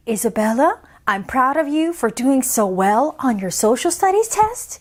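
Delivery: ordinary delivery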